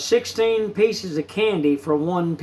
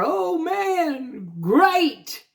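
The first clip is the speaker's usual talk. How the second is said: high, drawn-out imitated boyish yell; imitating a brother's high-pitched whoop; excited holler; 11 semitones higher, much slower than usual. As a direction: holler it high in delight, stretching the words. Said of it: "let out a yell and holler".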